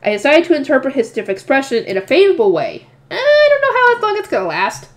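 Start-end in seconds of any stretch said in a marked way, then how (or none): 3.1-4.9 much higher, teasing line; wry aside. Real, not acted. Reader jokes about the favorable reading, high and wry.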